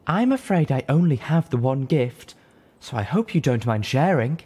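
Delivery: loud, high, sweet courtly voice; loud polite hostess; gracious offer. A narrator's reading. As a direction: sweetly bargain with them. Velvet offers gift to dragons.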